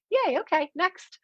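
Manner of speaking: high, sing-song fake cheer; chirpy mock yay, moving on; mock cheery shrug. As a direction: brush off the glitch with bright sarcasm. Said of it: "and I'm like".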